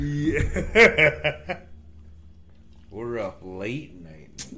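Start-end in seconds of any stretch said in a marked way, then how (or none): 0.0-2.9 laughing. Real, not acted. Laughing during sign-off.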